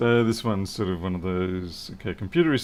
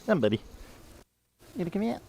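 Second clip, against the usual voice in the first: high coaxing pet talk, softer; cat voice; 10 semitones higher, 4 dB quieter, much faster than usual. Real, not acted. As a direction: gently lure him out. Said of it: "my cat voice".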